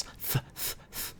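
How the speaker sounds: airy "th" with no voice at all; repeated voiceless "th" demo. Shown as a demonstration. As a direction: blow "th" through the teeth, just breath. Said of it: "and the voiceless".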